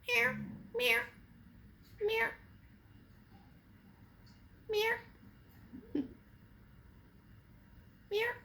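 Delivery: high imitated meow; mock cat meow. Meowing to lure cat.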